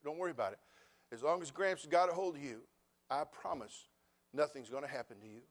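put-on soft, tender reassurance to a scared child; comforting granddaughter. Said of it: "I said".

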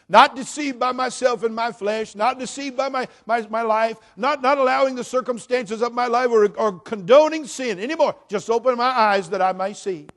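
high, loud, impassioned plea; full-force preaching prayer. Acted. Preacher praying for open eyes, impassioned shouting. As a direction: pour out the prayer at the top of the voice.

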